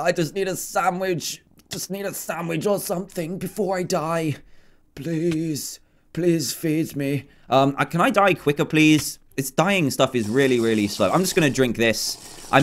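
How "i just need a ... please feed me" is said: softer, whiny starving plea; mock pleading whine. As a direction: beg for food like a starving man, hammed-up. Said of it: "I'm starving".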